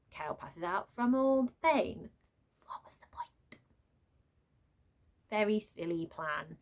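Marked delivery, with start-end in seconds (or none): 2.6-3.6 breathy whispered aside, barely voiced. Real, not acted. Reviewer whispering her complaint about the plan. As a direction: mutter it under your breath like a private gripe.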